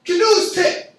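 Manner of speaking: punchline pitched high and loud; "canoes tip" punched high and loud. Shown as a demonstration. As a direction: land the joke on "tip". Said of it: "The point I'm trying to make is".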